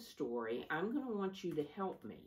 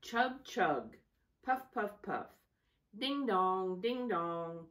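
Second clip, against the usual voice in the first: higher, louder chug-chug and ding-dong; train sounds; 4 semitones higher, 3 dB louder, slower than usual. Acted. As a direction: sing out the engine noises brightly. Story opens with train sounds.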